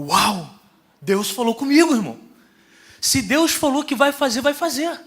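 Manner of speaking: high, loud exultant shout; fired-up excitement. Retold or imitated. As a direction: reenact bursting in, thrilled and certain. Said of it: "Cheguei empolgadão".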